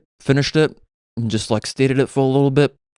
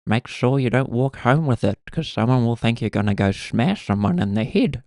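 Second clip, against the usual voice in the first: put-on grandmother's old voice; 3 semitones higher than usual. Grandmother warning her grandchild about carrying a hammer, old lady voice. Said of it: "she sounds a little bit like that now".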